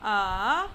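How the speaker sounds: "uh" dipping low, then rising; falling-rising pitch on "uh"; third tone. Shown as a demonstration. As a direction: draw "uh" down and back up. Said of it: "The third tone".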